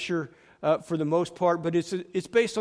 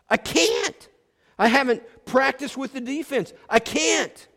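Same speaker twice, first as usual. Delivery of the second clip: anxious protest; 8 semitones higher, 3 dB louder than usual. Retold or imitated. Player panics at being sent in.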